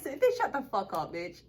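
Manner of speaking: ordinary talk, nothing marked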